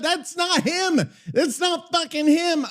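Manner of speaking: high-pitched, drawn-out disbelief; exasperated protest. Real, not acted. AI voice came out wrong.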